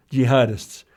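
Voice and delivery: ordinary talk, nothing marked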